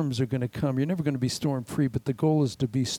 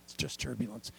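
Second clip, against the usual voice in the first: much softer, unvoiced comforting whisper; soothing whisper; 3 semitones lower, 13 dB quieter, faster than usual. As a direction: stewardess hushing a scared passenger. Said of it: "a pair of eyes going".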